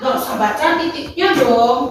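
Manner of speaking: ordinary talk, nothing marked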